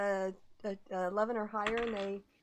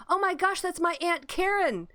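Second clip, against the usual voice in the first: high, louder, indignant cry; outraged relative; 8 semitones higher, 4 dB louder than usual. Acted. Game master voicing a miner finding his aunt, high indignant outcry. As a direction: demand answers, pitch way up. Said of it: "someone says".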